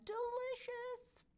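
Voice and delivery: very high, slow, sing-song Delicious; Delicious floated up high and dragged out; drawn-out delicious. Pretend. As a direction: stretch Delicious high and slow like a dreamy coo. Savouring a fan's dinner.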